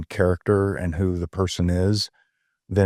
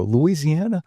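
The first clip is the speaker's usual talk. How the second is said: surprised; 9 semitones higher than usual. Retold or imitated. Surprised retort in retold story.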